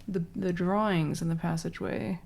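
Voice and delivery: normal talking voice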